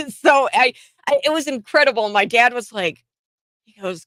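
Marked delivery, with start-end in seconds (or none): none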